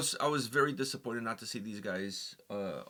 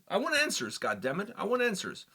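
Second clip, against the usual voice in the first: indignant raised demand, higher; mock outrage, insisting; 7 semitones higher than usual.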